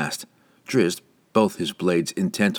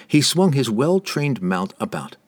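plain reading voice

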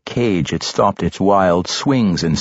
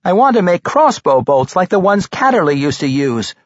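high, raised halfling voice; excited explaining; 8 semitones higher than usual. A narrator's reading. Halfling explaining himself to startled barbarian, excited. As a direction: explain eagerly in a lifted voice.

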